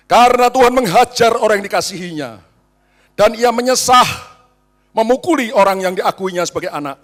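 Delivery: loud, ringing preaching of the verses; scripture reading. Acted. Preacher reading Hebrews to the church, loud and proclaiming. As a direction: proclaim the passage to the congregation.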